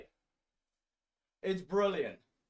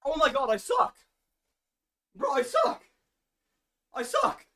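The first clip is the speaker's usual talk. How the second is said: frustrated yell; 9 semitones higher than usual. Real, not acted. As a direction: cry out at your own failure. Streamer failing a trick.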